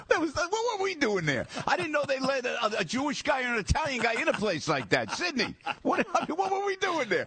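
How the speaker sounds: laughing disbelief. Real, not acted. Laughing recalling fancy golf club.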